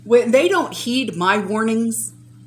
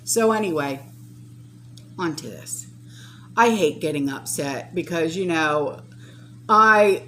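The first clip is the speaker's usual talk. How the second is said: ordinary talk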